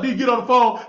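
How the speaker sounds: ordinary talk, nothing marked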